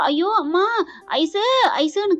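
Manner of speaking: high-pitched acted cry for help; mock wail, calling for someone; calling out in pain. A narrator's reading. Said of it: "கத்த".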